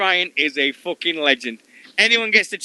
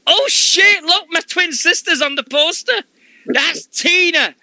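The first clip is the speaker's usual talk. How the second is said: excited quote; 12 semitones higher, slower than usual. Retold or imitated. Wrestler jokes her twin is on the poster, squealing high. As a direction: act her out, suddenly spotting the poster. Quoting Kaylee Ray's joke.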